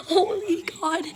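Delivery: breathy whisper of "Holy God"; whispered awe. Real, not acted. Buried under puppies, she whispers in awe. Puppies all over her.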